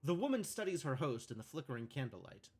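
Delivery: calm scene-setting read; script narration. A narrator's reading. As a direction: read the stage directions evenly. Reading game script narration.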